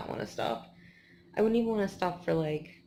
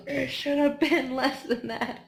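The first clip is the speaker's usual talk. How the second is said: laughing complaint; 6 semitones higher, 6 dB louder, slower than usual. Retold or imitated. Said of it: "And I'm like".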